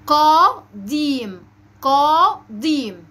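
very slow "قديم" in two held syllables; drawn-out model of "قديم"; emphatic initial qaf. Shown as a demonstration. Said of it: "هنقول إيه؟".

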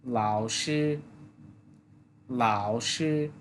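老师: low dipping third tone, then level first tone; Chinese tones. Shown as a demonstration. Teacher models 老师 tones for students. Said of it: "third tone, প্রথম অংশ আর কি".

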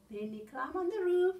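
usual speaking voice

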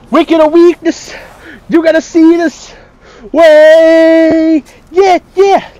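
loud, high hype yells; excited shouting. Real, not acted. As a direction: yell out in pure hype. Hyped shouting during game.

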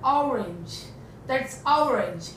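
orange said slowly, opening on a short ऑ; slow careful ऑरेंज with open first vowel; model pronunciation. Shown as a demonstration. Teacher shows class how orange is really said. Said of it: "ओ को अ उ बोला जाता है".

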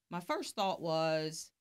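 ordinary talk, nothing marked

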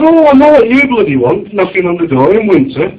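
high, loud exasperated rant; mock angry rant. Annoyed homeowner ranting at the gas man, high and loud. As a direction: rant at the doorstep salesman. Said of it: "I said".

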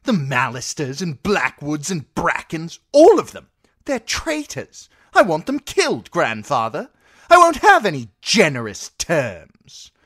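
put-on a furious spoilt child-king throwing his weight; spoilt boy king. Boy king demands executions.

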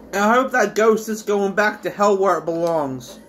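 normal talking voice

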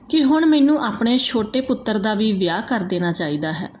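usual speaking voice

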